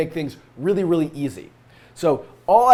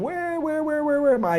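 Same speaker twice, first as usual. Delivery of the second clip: much higher playful where; singsong, hunting for something; sing-song searching; 10 semitones higher than usual. Real, not acted. Searching for place in code.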